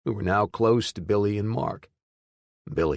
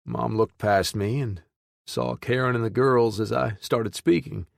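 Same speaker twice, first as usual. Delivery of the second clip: usual speaking voice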